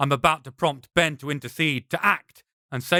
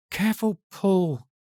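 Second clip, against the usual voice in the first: hiss through clenched teeth; 6 semitones higher than usual. Acted. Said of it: "he hisses through clenched teeth".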